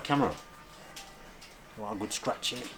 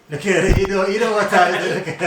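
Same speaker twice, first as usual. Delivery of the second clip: laughing; 5 semitones higher, 5 dB louder than usual. Real, not acted. Excited laughing at a dog.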